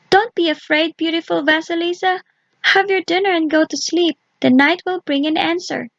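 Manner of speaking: high, gentle doll voice; kind little doll. Acted. Doll comforting Vasilisa at night, high and gentle. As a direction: soothe the frightened girl in a small high voice.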